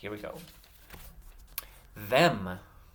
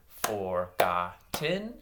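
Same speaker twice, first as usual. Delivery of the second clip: FORGOTTEN broken into slow, separate syllables; 5 semitones lower, much slower than usual. Shown as a demonstration. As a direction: clap out "forgotten", a gap after each syllable. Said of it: "I'm gonna say".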